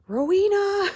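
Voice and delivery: loud, high mock call of her name; delighted call. Quoting Dean greeting Rowena, loud and high. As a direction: sing out the name loudly.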